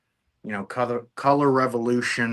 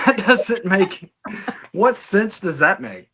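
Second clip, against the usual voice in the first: high, louder, breaking into a laugh; laughing incredulity; 10 semitones higher, 4 dB louder than usual. Real, not acted. Guest scoffing at an absurd hypothetical, laughing incredulously. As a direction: laugh through the words in disbelief.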